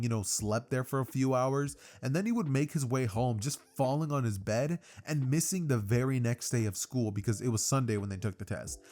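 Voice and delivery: ordinary delivery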